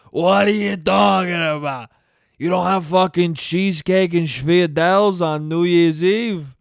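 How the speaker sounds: a shouted mock Italian New Yorker; a hammed-up shout from the Italian uncle; mock Cuomo outrage. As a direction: bark back like an offended uncle. Cuomo answers reporter, shouting.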